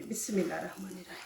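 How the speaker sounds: fast, soft under-the-breath bismillah; hushed quick blessing before flipping the paratha; murmured prayer. Real, not acted. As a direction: rattle it off quietly to yourself.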